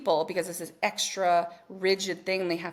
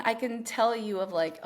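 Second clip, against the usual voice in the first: ordinary talk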